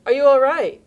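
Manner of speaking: a loud, high call down the hall; yelled question. Shown as a demonstration. Checking on someone hurt down the hall, loud calling out. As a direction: call out to someone in another room. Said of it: "you might wanna yell in".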